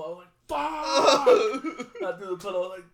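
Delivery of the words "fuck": loud, high yell of frustration; reenacted cursing yell. Fan reliving his team losing the pick, frustrated yell. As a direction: yell it as if into a pillow.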